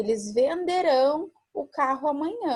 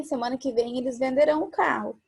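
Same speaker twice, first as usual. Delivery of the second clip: ordinary talk, nothing marked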